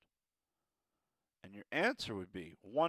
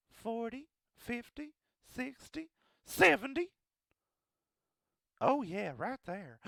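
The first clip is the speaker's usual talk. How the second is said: much higher, rising on each number; high sing-song count; 11 semitones higher than usual. Shown as a demonstration. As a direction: climb the count playfully.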